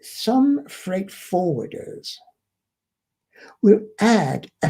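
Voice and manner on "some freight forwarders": "some" drawn out slowly with a falling tune; slow, weighted "some freight forwarders"; stress on some. Shown as a demonstration. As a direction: dwell on "some" so it sounds like only some. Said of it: "I emphasize the word some".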